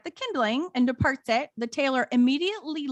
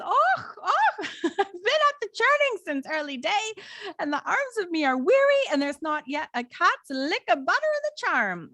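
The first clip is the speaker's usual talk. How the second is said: put-on high, lamenting Irish accent; hammed-up Irish brogue, pitched high; 6 semitones higher than usual. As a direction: wail it as a weary Irish housewife. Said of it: "do you like my accent?".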